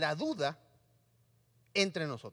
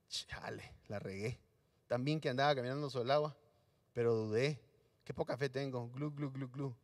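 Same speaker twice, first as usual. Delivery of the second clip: mock whispered sinking; 9 semitones lower, 7 dB quieter than usual. Mock Pedro sinking, quiet.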